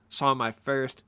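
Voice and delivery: ordinary talk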